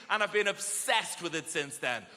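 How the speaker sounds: usual speaking voice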